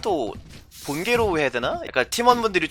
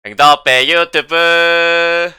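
drawn-out shout; 5 semitones higher, 11 dB louder, much slower than usual. Acted. Channel plug, drawn out slogan.

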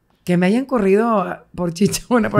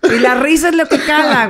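riendo; 8 semitones higher, 6 dB louder than usual. Real, not acted. Riendo al recordar la burla.

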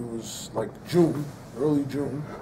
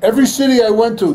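higher, louder sermon at full force; fiery preaching; 8 semitones higher, 13 dB louder than usual. Acted. Rabbi preaching to the crowd about Am Yisrael, fiery and loud. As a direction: preach it, building fire.

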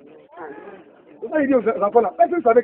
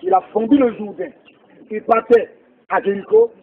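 ordinary talk

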